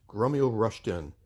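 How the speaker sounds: usual speaking voice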